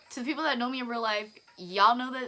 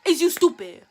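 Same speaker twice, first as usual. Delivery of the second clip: sassy mock scolding building louder; mock viewer scolding; 2 semitones higher, 5 dB louder than usual. Imagined viewers mock Dexy, sassy scolding.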